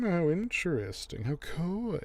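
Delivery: high-pitched mock flirtation; retold coy woman. Neely thinking Alan means her, mock coy. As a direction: play her delightedly reading into his hint. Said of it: "she's like".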